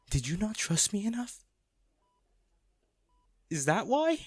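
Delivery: begging the sleeping lover for an answer, anguished; anguished pleading. Acted. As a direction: plead with rising hurt.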